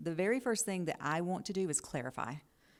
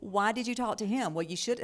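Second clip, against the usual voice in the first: higher, louder rebuke; mock criticizing; 6 semitones higher, 6 dB louder than usual. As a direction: fire off an accusing question. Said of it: "then I start criticizing that".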